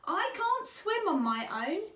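high-pitched little otter squeal. A narrator's reading. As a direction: squeal it like a nervous cub. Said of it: "he squealed".

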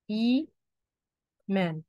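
"إيمان" very slowly, split as "إي" ... "مان"; drawn-out syllables. Shown as a demonstration. Teacher showing students the long vowel in an example word, slowly sounded out.

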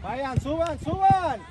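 high shout urging players forward; calling out. Real, not acted. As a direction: yell it up the field. Urging players forward during the match. (grito para que suban)

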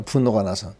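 ordinary talk, nothing marked

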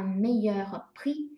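meilleur prix very slow, prix rising; slow teaching demo; slow clear French. Shown as a demonstration. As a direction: spell it out in sound. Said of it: "用meilleur和".

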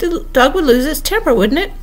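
high-pitched exclaimed explanation; crying out. A narrator's reading. Red Queen explaining her answer to Alice, crying out. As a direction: keep the pitch up, insistent. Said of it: "the Red Queen cried".

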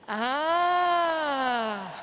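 long, loud, swelling ah; wondering ah. Shown as a demonstration. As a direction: let out a big open ah of wonder. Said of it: "we're gonna go like this".

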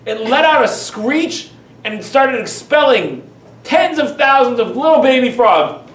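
normal talking voice

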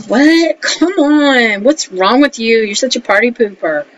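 mock whiny friend. Friends mocking her for staying home.

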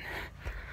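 soft, long weary sigh; tired sigh. Real, not acted. Dad sighing wearily in the sweltering attic, soft sigh. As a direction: breathe out the heat and fatigue.

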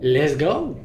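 loud excited cheer. Real, not acted. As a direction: hype yourself up. Pumped up start.

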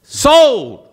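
high, loud auctioneer call; auctioneer's sold. Retold or imitated. Auctioneer closes the sale, high and loud. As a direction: slam down the word like a gavel.

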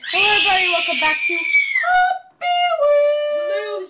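high, loud YouTuber intro impression; Jacksepticeye. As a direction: shout the greeting, then stretch the title into a shriek. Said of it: "Okay, now it's my turn".

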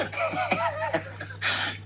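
high wheezy laughter; relieved laugh. Real, not acted. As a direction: laugh at having been fooled. Dad laughs at being fooled.

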